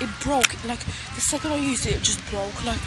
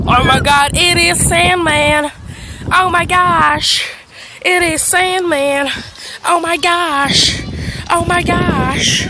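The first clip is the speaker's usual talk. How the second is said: excited; 6 semitones higher, 11 dB louder, slower than usual. Real, not acted. Excited discovery, slow, loud.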